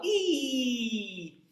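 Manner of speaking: a pointed, narrow ee. Shown as a demonstration. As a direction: squeeze ee thin. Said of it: "narrowest vowel".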